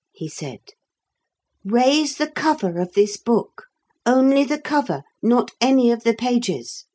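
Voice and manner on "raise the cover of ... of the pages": put-on high, careful instructions from a child; boy giving orders. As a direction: direct the men in a high boy's voice. Philip, boy figure, giving orders.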